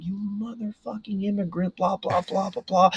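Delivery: high-pitched, held-back imitation of a ranting man; mock screaming. Angry customer barging out at the new kid, mock screaming. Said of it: "starts screaming".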